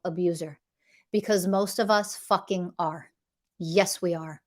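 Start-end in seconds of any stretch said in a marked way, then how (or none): none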